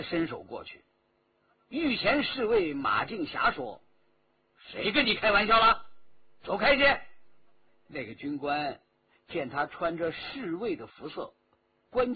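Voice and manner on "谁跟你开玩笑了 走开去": loud, high stern rebuke; angry order. A narrator's reading. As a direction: put him in his place. Guard orders officer away.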